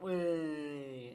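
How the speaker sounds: loud, drawn-out mock cheer; crowd cheer. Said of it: "Everyone's like".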